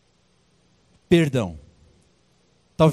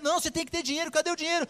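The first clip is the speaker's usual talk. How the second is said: much higher, insistent demand; pushy creditor; 11 semitones higher, 6 dB quieter, much faster than usual. Acted. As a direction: hound him for the money. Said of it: "falou assim".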